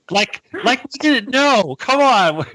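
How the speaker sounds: high, loud scoffing; incredulous, drawn-out sarcasm; sarcastic disbelief. Real, not acted. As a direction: scoff as if everyone knew all along. Scoffing at claimed ignorance.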